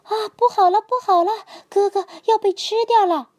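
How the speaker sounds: little frogs crying out in alarm; alarmed yelling. A narrator's reading. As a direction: shriek the alarm, brother is in danger. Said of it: "弟弟们看到后大叫".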